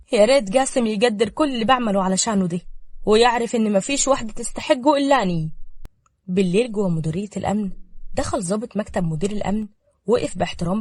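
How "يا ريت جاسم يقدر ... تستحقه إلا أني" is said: usual speaking voice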